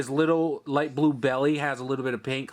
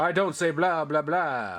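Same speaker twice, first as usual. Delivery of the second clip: vampire voice. Pretend. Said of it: "Can you guys do a vampire voice?".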